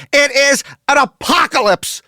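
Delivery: high, loud burst of rapture; over-the-top excited shriek; mock-ecstatic. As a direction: squeal it like a revelation. Building rave about gas station.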